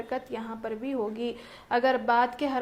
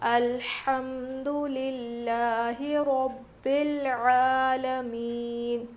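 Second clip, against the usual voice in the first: slow, drawn-out recitation voice; tajweed recitation; much slower than usual. Shown as a demonstration. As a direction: recite it as a model for students. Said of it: "کس طرح سے پڑھنا ہے اس کو دیکھیے". (تلاوت کے انداز میں آہستہ پڑھنا)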